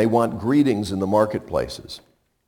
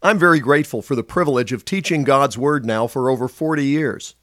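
brisk, upbeat announcer read; radio ministry appeal read; 5 semitones higher, 5 dB louder than usual. Acted. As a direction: pitch the ministry warmly to listeners.

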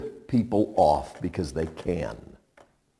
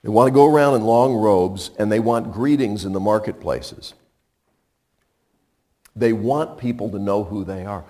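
ordinary talk, nothing marked

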